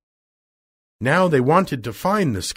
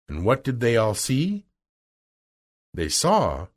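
ordinary delivery

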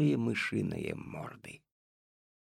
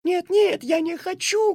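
sobbing; 8 dB louder than usual. Acted. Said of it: "громко зарыдала Катя".

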